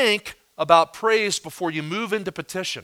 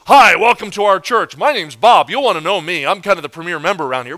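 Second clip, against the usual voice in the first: loud, self-important greeter; proud person; 2 semitones higher, 9 dB louder than usual. Acted. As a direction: boom a boastful welcome to church visitors. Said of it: "the proud person is".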